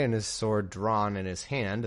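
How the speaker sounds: ordinary delivery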